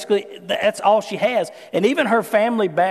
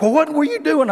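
higher, louder mock accusing snap; exasperated husband; 7 semitones higher, 3 dB louder than usual. Husband imagines scolding his wife: mock exasperated. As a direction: play the annoyed husband, lightly. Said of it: "The man in me wanted to be like".

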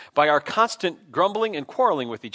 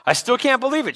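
higher, louder quote of amazement; retold disbelief; 10 semitones higher, 5 dB louder than usual. Waitress reacting to an unexpected gift, retold disbelief. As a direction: voice her disbelief, pitched up.

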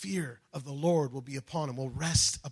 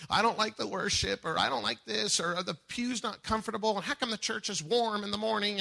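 mock complainer; 6 semitones higher than usual. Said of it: "we're complaining and going on".

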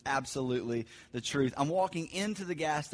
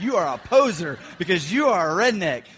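teasing accusation; 3 semitones higher, 11 dB louder than usual. Retold or imitated. Friends calling him out.

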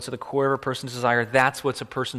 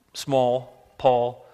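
ordinary talk, nothing marked

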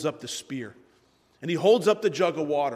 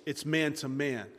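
usual speaking voice

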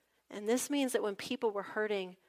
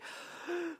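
sharp gasp; 9 semitones higher, 5 dB quieter than usual. Retold or imitated. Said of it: "Then you have to be like".

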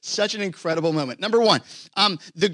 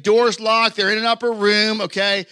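normal talking voice

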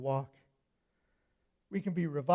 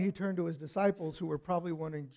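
usual speaking voice

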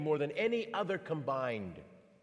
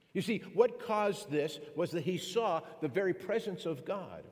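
usual speaking voice

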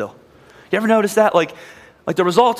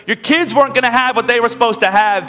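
full-force preaching; 6 semitones higher than usual. Acted. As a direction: drive it home from the pulpit with rising intensity. Pastor preaches the climax at full force.

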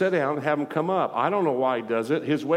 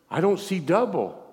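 higher-pitched acted disbelief; retold astonishment; 6 semitones higher than usual. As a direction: voice her first amazed realization, not yet shouting. Acts out woman discovering healing.

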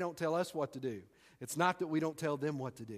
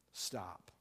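stop, dropped low and soft; hushed sudden stop; 11 semitones lower, 13 dB quieter than usual. Shown as a demonstration. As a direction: let the word fall away quietly. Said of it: "I'm gonna say that again".

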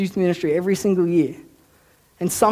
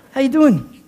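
higher, louder chatty greeting; retold friendly greeting; 8 semitones higher, 5 dB louder than usual. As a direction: greet a stranger breezily, pitched up. Retold casual greeting to a stranger.

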